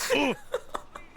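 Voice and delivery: high startled gasp; sharp gasp. Acted. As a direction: gasp in sudden shock.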